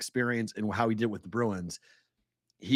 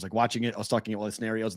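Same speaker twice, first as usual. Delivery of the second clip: ordinary delivery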